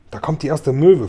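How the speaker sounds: usual speaking voice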